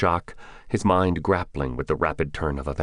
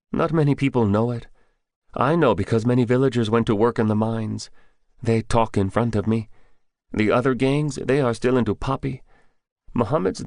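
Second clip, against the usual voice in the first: higher, lightly voiced young Afghan man; higher young man telling what he knows; young villager Bidar; 7 semitones higher than usual. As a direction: voice the scared young grocer confiding.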